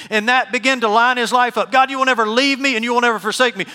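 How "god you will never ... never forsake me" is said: loud, high, quicker cry to God; imitated scream. Preacher repeating Luther's cry from the field, mock scream. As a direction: belt out the declaration in one rush. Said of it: "he screamed this".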